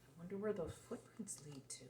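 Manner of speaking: hushed whisper, building suspense. Acted. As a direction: whisper it to draw the child in. Suspense while reading to a child.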